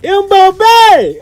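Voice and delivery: high, elated cry; a fan crying out in joy; excited shout. Real, not acted. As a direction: yell it like a stadium chant. Excited chant.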